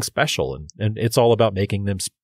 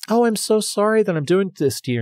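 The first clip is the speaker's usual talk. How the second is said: higher-pitched imitation of a sorry buyer; apologetic customer; 10 semitones higher than usual. Voicing apologetic buyers in retold talk.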